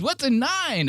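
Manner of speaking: very high, frantic mock voice with a laugh; panicked Mark. Teasing Mark's terror at a nicotine pouch, squealing high. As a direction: squeal it like he thinks nine means a gun. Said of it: "He goes".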